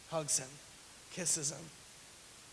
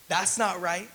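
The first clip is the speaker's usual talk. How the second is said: indignant protest; 7 dB louder, slower than usual. Acted. Protest repeated louder.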